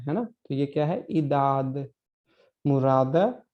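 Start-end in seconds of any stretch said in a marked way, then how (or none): 1.1-1.9 "इदाद" ending cleanly on a dental d; dental, silent final e. Shown as a demonstration. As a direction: say "इदाद" with no vowel after the last d. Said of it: "हमें इसे इडाडे या इदादे नहीं". ("इदाद" का अंत द पर, बिना "ए")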